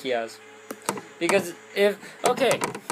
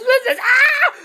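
sudden laughing shriek; 6 dB louder than usual. Real, not acted. Panicked outburst mid-sentence.

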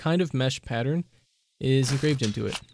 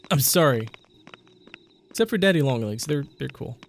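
ordinary talk, nothing marked

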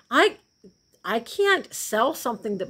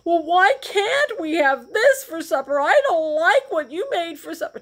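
put-on higher, louder childish grumbling about supper; mock kid complaint; 9 semitones higher, 6 dB louder than usual. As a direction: whine like a picky kid at the table. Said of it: "grumble and complain and say".

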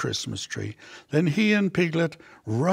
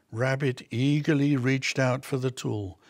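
plain reading voice

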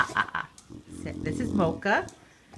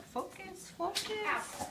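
coaxing camera; 12 semitones higher, 7 dB quieter, much slower than usual. Real, not acted. Pleading with the blurry camera.

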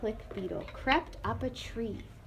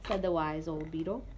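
ordinary delivery, nothing marked

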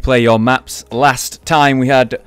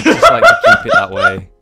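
laughing through the words; giggling, can barely get it out; 6 dB louder than usual. Real, not acted. Laughing while talking.

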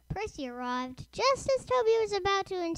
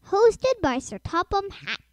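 usual speaking voice

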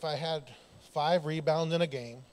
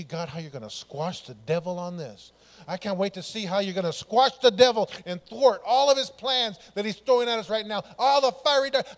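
swelling, louder eager words to God; eager prayer; 2 semitones higher, 7 dB louder than usual. Retold or imitated. Believer telling God he can't wait, rising excitement. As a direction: build the excitement as you pray.

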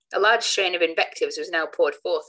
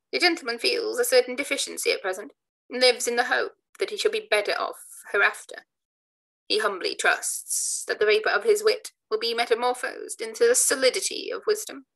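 sneering; 7 semitones higher than usual. Acted. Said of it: "shaking his head with a sneer".

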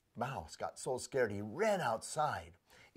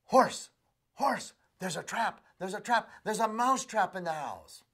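high, urgent little mouse warning; louder, pleading mouse voice; frantic mouse; 7 semitones higher, 5 dB louder than usual. Acted. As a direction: squeak out the alarm, breathless.

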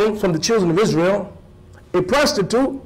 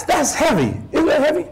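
normal talking voice

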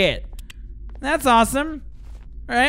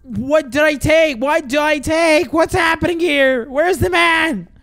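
frantic alarm; 4 semitones higher than usual. Real, not acted. Player losing track of the repairing man, frantic alarm. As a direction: wail the questions with rising urgency.

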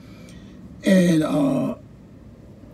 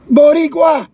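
loud, high proud yell; poet's shout; 8 semitones higher, 7 dB louder than usual. Retold or imitated. Street poet shouting to the students, loud proud yell. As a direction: shout the word out with pride. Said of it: "screams and yells and says".

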